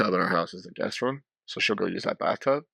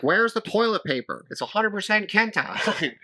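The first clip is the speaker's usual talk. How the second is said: mock Joseph complaining; 10 semitones higher than usual. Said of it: "And Joseph's like".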